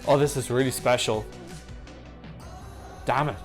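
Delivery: ordinary delivery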